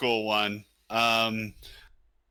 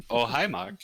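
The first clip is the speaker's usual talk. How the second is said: Tommy Wiseau impression; 3 semitones lower than usual. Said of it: "just doing Room quotes".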